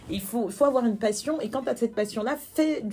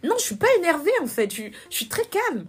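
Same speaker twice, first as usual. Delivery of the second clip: high, loud re-enacted denial; retold exasperated objection; indignant retort; 8 semitones higher, 6 dB louder than usual. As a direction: burst out in protest, pitched right up. Retorts to accusation of being upset.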